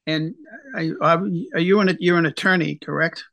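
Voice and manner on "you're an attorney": usual speaking voice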